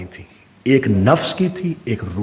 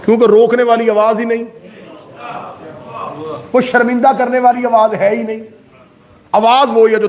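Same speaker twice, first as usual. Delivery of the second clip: preacher rising to a peak; impassioned preaching; 7 semitones higher than usual. Acted. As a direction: press the point home with fire. Preaching climax.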